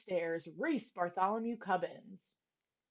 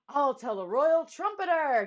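shouted; 5 semitones higher, 7 dB louder than usual. A narrator's reading. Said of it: "he shouted".

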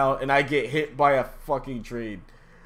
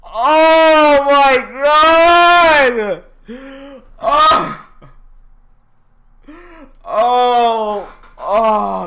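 much higher, louder, very slow wail; anguished cry; 12 semitones higher, 12 dB louder, much slower than usual. Real, not acted. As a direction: wail it out in despair. Crying out at the game.

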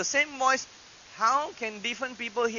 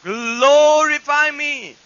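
loud, high, stretched-out quoted proclamation; booming voice of God; 6 semitones higher, 9 dB louder, much slower than usual. Acted. Someone reporting the voice they heard, booming proclamation. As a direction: boom it slowly as the heavenly voice. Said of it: "I heard that voice say".

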